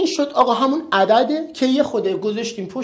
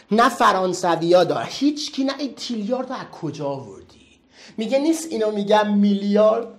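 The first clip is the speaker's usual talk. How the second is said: ordinary talk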